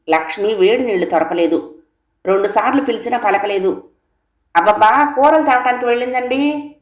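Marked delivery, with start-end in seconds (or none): 4.6-6.8 calling out. A narrator's reading. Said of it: "శాంతంగా కేకేసింది".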